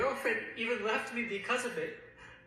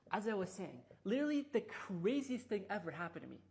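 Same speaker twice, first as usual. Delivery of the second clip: ordinary talk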